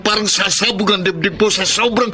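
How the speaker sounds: rising pained voice, pleading then breaking; anguished outburst. Acted. Husband confronting his wife, voice rising with hurt. Mark confronts Yvette in anger.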